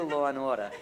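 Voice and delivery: Law and Order loud, with R after Law, none in Order; Brooklyn pronunciation. Shown as a demonstration. Speaker admitting defeat to the audience, Law and Order in Brooklyn pronunciation. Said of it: "I can't do".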